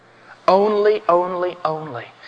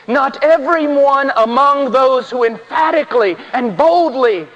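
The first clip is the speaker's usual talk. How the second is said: raised, insistent pulpit voice; emphatic, high-pitched sermon delivery; full-force preaching; 8 semitones higher, 4 dB louder than usual. Real, not acted. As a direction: drive each point home to the congregation, pitched high.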